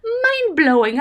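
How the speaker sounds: high, slow, drawn-out awe; mock amazement. Retelling her first reaction, in mock amazement. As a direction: act out being floored. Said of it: "And I was like".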